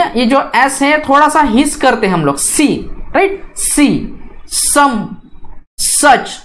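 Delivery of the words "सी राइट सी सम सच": सी, सम, सच with a sharp hissing S; hissed S. Shown as a demonstration. Teacher shows students the hissed S in सी, सम, सच. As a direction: let the S in सी, सम, सच hiss out. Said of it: "इस type से हिस करते हैं".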